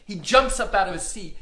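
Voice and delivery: ordinary delivery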